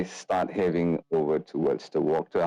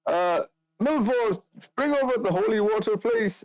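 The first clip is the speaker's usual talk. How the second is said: much higher-pitched priest voice; priest character. Acted. Priest asks his helper for holy water, high put-on voice. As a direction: play a priest in a raised, put-on voice.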